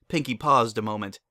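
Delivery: ordinary delivery, nothing marked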